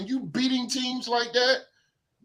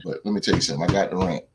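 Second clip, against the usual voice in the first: usual speaking voice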